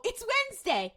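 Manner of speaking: high, loud gleeful announcement; imitated excited cry. People quoting the gif of this episode, excited cry. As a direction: proclaim it like a popular meme. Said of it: "where people go".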